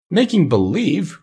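high, drawn-out astonished question; crying out in disbelief. A narrator's reading. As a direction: echo it slowly and high, incredulous. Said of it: "cried Dorothy".

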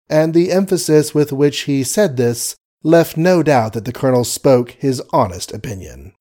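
usual speaking voice

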